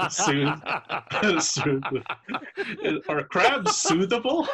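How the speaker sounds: high, loud, words breaking into laughter; helpless laughter through the words; real laughing disbelief. Real, not acted. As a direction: crack up while asking. Game master cracks up at soothing crabs.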